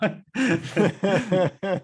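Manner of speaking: bright burst of laughter; real amused laugh. Real, not acted. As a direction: laugh at the joke. Laughs at own joke.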